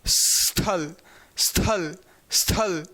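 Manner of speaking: स्थल with a half स then थ, said low; teacher drilling स्थल for students; correct pronunciation drill. Shown as a demonstration. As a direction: repeat स्थल slowly, half स joined to थ. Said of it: "आधा स जैसे आप बोलिए वहाँ पर थ जोड़ दीजिए".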